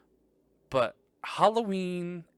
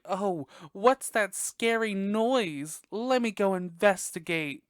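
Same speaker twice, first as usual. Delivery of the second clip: high, slow, wide-eyed innocent voice; hammed-up scared curiosity; mock naive horror victim; 7 semitones higher, 4 dB louder, slower than usual. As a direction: play the clueless girl walking toward danger. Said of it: "Not me, like, acting like that".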